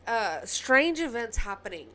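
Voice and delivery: ordinary talk, nothing marked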